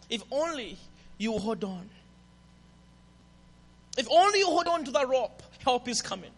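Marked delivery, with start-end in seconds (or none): none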